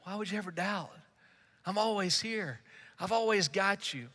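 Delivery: soft, gentle, close; tender reassurance. Retold or imitated. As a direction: reassure him like a friend holding his hand. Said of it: "then he goes".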